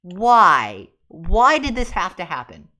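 loud, drawn-out exasperated complaint; exasperated overreaction. Real, not acted. Fan ranting about Zero's southern accent, loud and exasperated. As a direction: overdo the dismay, crying out loudly. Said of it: "am I overreacting about Zero the Hutt".

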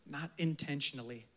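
2 soft, intimate prayer slipping into whispers; 3 pray low and tender, drifting to a breath; 1 hushed prayer. Acted. 4 pastor leading the congregation in closing prayer, hushed and tender.

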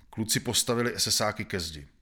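ordinary delivery, nothing marked

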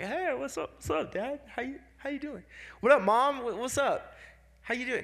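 high, sweet buttering-up voice; teen buttering up mom and dad, high and sugary; sucking up to parents. Retold or imitated. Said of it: "I was terrible at this".

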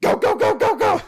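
high shouted raid command; shouting. Acted. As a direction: yell go, high and loud. Acted FBI raid.

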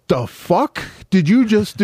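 high-pitched, incredulous retold protest; mock outraged disbelief. Goddess confronts trickster over the deal, mock outrage, high.

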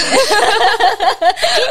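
bursting high laugh; real laugh, high and loud. Real, not acted. Laughing at own retort. (高く大きな笑い声)

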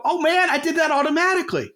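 high, excited realization; high-pitched mock amazement; amazed inner voice. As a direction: squeal it out like a sudden discovery. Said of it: "you're gonna feel something in your brain go".

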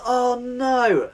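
put-on high, crestfallen "oh no" as the boy; gutted. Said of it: "like, gutted".

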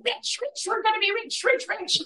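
a greedy cartoon coyote cheering over money; Wile E. Coyote gloating. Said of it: "it was like Wile E. Coyote throwing money up in the air saying".

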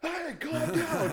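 very high, drawn-out mock scream; hammed-up frantic shout. Passenger shaking a panicking woman, hammed-up high shout. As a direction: yell it slowly as if shaking someone. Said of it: "they're shaking them".

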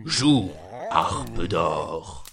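lightly voiced giant command; dim slow booming giant. A narrator's reading. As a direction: order the harp like a giant. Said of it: "dit le géant".

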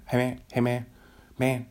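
put-on Southern good-old-boy voice; Southern accent. Shown as a demonstration. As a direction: play a podcaster with a Southern accent. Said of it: "the rest of the podcast like this".

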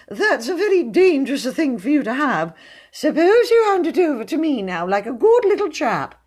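high, quavering coaxing; uneasy adult humouring a boy, pitch way up; nervous. A narrator's reading. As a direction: coax a dangerous child anxiously. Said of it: "laughed nervously".

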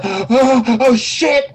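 a mock shocked wake-up cry; startled realization. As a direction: blurt it out as it hits you. Realization after gasp.

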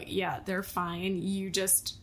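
ordinary talk, nothing marked

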